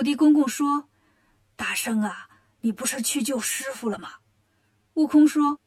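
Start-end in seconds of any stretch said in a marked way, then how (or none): 1.6-4.2 low, hushed Earth God voice; 土地公公. Acted. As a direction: speak softly and low as a humble local god. 土地公公答悟空的问话. (土地公公低沉轻声)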